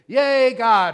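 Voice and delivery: loud, high, drawn-out cheer; big cheer. Shown as a demonstration. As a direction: whoop it out for the whole congregation. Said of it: "then you're supposed to say".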